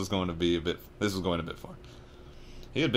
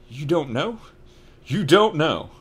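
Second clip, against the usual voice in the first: loud, rising then exclaimed; can't believe it; disbelief; 9 semitones higher, 10 dB louder, slower than usual. Acted. Hagrid realising Harry knows nothing, disbelieving.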